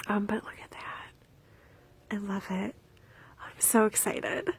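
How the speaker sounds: softly whispered delight; whispered excitement. Real, not acted. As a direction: hush it like a secret. Quiet delight over buttons.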